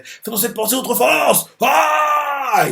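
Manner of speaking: rally yourself with a yell; soldier's war cry. Retold or imitated. Soldier fired up by the speech, yelling. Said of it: "Il crie, quoi".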